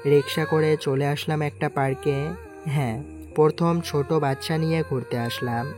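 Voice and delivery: plain reading voice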